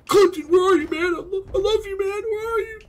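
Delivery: very high, loud mock wail; desperate calling. Gamer calls for missing teammate, high hammed-up wail. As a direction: cry out in a piercing high voice.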